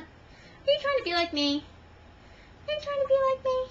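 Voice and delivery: high-pitched cooing to a cat; pet talk. Real, not acted. Pregnant woman to her maybe pregnant cat, high pet talk. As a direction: coo a teasing question high.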